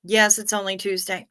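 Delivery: normal talking voice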